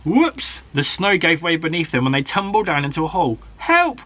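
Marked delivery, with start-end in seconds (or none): 0.0-0.6 high startled whoops; surprised yelp. A narrator's reading. Tumbling into a hole. 3.6-4.0 high, loud frightened cry; cry for help. A narrator's reading. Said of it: "cried Alfie".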